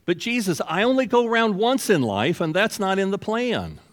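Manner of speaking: high-pitched pleading objection; whiny protest. Acted. A would-be follower objecting to Jesus, whiny protest.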